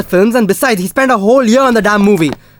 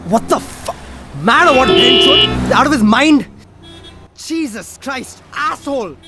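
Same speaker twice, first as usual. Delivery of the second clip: drawn-out, high-pitched cursing; road rage; 7 semitones higher, slower than usual. Acted. Driver cursing another car, enraged. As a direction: rail at a reckless driver, stretching the words.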